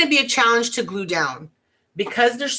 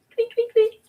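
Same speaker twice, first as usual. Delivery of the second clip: much higher, quick little tweets; chirping bird noise. Pretend. Crafter voicing the chick ornaments, high quick tweets.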